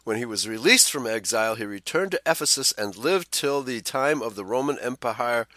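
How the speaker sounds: ordinary delivery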